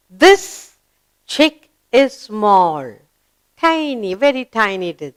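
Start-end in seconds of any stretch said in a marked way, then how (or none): 0.1-2.8 put-on slow, deliberate reading for children; slow read-aloud. Shown as a demonstration. Teacher reading a sentence slowly to children. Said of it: "Let's read".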